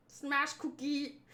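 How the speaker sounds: slow gruff puppet monster; Cookie Monster voice. Pretend. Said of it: "do a Cookie Monster voice".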